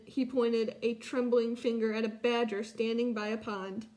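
ordinary delivery

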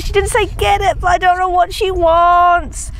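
a baffled dog thinking aloud; dog's thoughts. Retold or imitated. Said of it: "she goes".

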